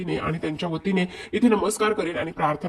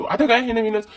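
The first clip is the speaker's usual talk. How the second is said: higher, louder imitated objection; mock protest; 6 semitones higher, 5 dB louder than usual. Husband answering his scolding wife, mock protest. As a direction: playfully act out a puzzled protest.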